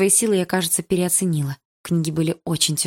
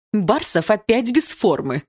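scolding; 4 semitones higher, slower than usual. A narrator's reading. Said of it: "выговаривала Атом".

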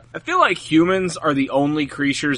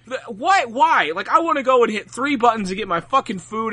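normal talking voice

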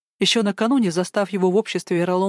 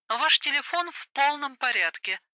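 operator voice; 7 semitones higher, 5 dB quieter than usual. Acted.

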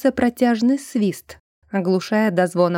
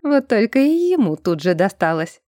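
ordinary delivery, nothing marked

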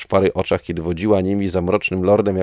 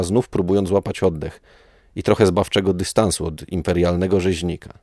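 plain reading voice

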